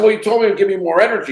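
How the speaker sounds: retold rushed protest to the doctor, high-pitched; indignant protest. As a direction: fire it back fast, half-accusing.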